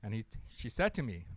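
ordinary delivery

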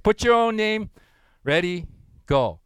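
usual speaking voice

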